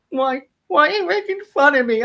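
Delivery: imitating a hungover, near-tearful protest; almost crying. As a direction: complain pitifully, as if too sore to cry. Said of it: "almost crying, but I can't cry".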